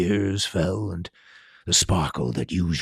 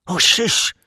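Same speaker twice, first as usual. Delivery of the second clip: hissed shush; 11 semitones higher, 6 dB louder than usual. A narrator's reading. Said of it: "hissed Professor McGonagall".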